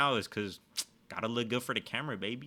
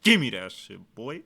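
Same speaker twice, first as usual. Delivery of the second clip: mock bossy pro; 7 dB louder than usual. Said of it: "be like".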